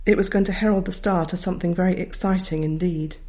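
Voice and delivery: usual speaking voice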